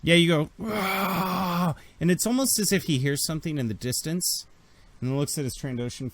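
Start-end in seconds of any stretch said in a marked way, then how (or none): 0.6-1.7 high, drawn-out rasping bark; acted animal cry; creature bark. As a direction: let out a long, high bark. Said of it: "bark at them".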